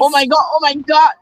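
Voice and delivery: high excited squeal; shrieking jackpot winner. Real, not acted. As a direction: squeal with thrilled disbelief. Gets supplies from teammate.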